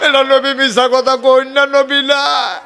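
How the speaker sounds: high, loud preaching cry; fervent shout. Acted. Preacher to the crowd, repeating the line as a loud high cry. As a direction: proclaim it at full force to the crowd.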